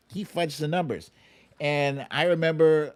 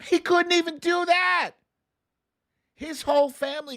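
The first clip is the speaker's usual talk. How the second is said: much higher, louder burst of disbelief; exasperated outburst; 4 dB louder than usual. Real, not acted. Railing at Trump's silence on his vaccine, outraged. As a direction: throw it out in loud disbelief.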